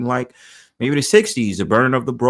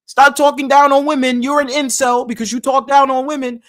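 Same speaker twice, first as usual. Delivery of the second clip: much higher, louder whiny mock voice; mocking opponents; 12 semitones higher, 6 dB louder than usual. Mocking the idiots in his argument sprees, high and whiny. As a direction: parody the people lecturing him. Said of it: "argument sprees with these idiots".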